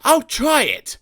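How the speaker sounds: high, eager little-engine voice; brightening at the scheme. A narrator's reading. Sir Handel taking up Gordon's idea, high and eager. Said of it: "said Sir Handel".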